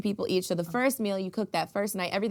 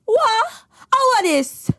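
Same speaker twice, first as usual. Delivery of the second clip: loud, high furious yelling; yelling Jamaican parent; 10 semitones higher, 4 dB louder, slower than usual. Retold or imitated. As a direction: yell down the stairs at your kid. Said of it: "impression of your mom or auntie".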